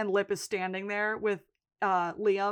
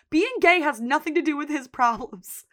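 retold Fiona retort; 6 semitones higher, 6 dB louder than usual.

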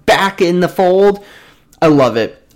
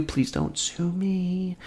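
quiet aside; 16 dB quieter, much slower than usual. Real, not acted. Joking aside to news outlets.

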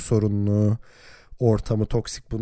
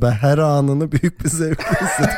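gloating through a laugh; teasing laugh; 6 semitones higher than usual. Real, not acted. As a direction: tease with a laugh. Teasing rival fan.

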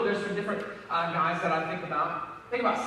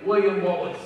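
attempted Scottish accent; amateur Scottish accent on the name; best Scottish accent; 3 semitones higher, 7 dB louder than usual. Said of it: "that's my best Scottish accent".

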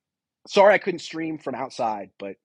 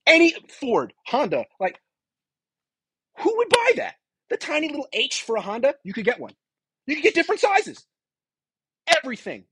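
amazed; 13 semitones higher than usual. Real, not acted. Amazed at flea market parts.

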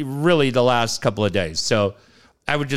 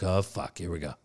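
dreading mutter; 9 semitones lower, 11 dB quieter than usual. Retold or imitated. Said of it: "I'm like".

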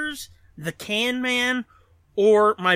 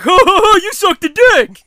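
loud, high mocking guffaw and taunt; hammed-up heckle, stretched out; mock jeering; 10 semitones higher, 15 dB louder than usual. Storyteller mimicking friends who interrupt, loud mock jeering. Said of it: "you fuckers are like".

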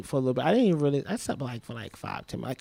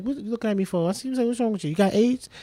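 joking; 7 semitones higher, faster than usual. Retold or imitated. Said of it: "Like, jokingly".

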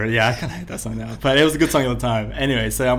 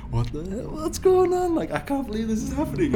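very high, squeaky disbelief; overwhelmed; 14 semitones higher than usual. Retold or imitated. Starstruck fan beside [name], overwhelmed high squeal. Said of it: "'cause I'm so overwhelmed".

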